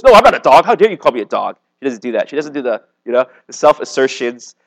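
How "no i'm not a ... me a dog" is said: a higher, louder, hammed-up offended comeback; mock indignant retort. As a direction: play the insulted woman snapping back in pretend outrage. Mock defensive retort she never said.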